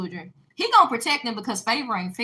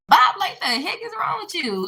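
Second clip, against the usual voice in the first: high, raised-voice mock outburst; retold snapping; 9 semitones higher, 3 dB louder than usual. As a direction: re-enact losing patience, pitch jumping up. Re-enacts snapping at the bully.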